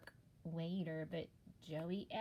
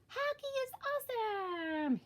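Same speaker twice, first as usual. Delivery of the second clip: high, excited shark cheer; roaring cheer; 14 semitones higher than usual. Acted. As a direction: shout with joy on the ice. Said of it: "he roared".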